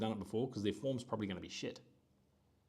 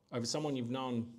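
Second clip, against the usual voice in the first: normal talking voice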